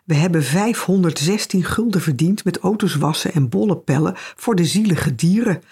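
ordinary delivery, nothing marked

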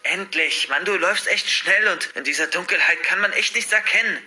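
catching up after a chase; out of breath. A narrator's reading. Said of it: "dass er außer Atem ist". (etwas außer Atem)

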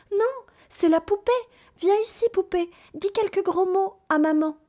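put-on a child proudly showing off her doll to mum; high piping child calling her doll; little girl voice.

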